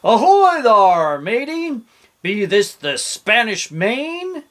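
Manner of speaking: slow, high, loud pirate hail; hammed-up pirate yell; yelling pirate captain. A narrator's reading. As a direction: call out like a booming pirate captain. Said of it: "the head pirate ... yelled".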